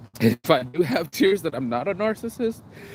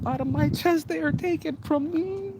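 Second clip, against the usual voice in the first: very high, softening, anguished voice; tearful anguish; 11 semitones higher, 4 dB quieter than usual. Real, not acted. Parent grieving a child taken away, high and tearful.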